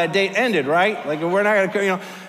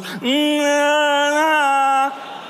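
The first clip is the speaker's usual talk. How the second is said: high, louder mock whimper; retold frightened whimper; 8 semitones higher, 4 dB louder, much slower than usual. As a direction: imitate her panicky whimpers, hammed up. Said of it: "she goes".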